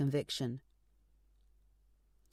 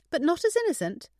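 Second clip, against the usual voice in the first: joking; 11 semitones higher, 6 dB louder than usual. A narrator's reading. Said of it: "she joked".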